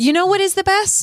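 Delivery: high-pitched, eager lead-in; excited build-up. Real, not acted. Host sets up the giveaway winner announcement, excited.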